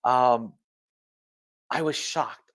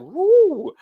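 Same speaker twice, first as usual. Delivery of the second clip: high, loud woo; excited little cheer; 10 dB louder than usual. Real, not acted. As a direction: whoop with glee.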